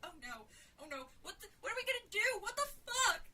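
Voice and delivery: fearful moan building to a high scream; mounting hysteria. A narrator's reading. Jill gripping Sarah's arm in darkness, hysterical panic.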